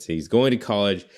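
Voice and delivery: normal talking voice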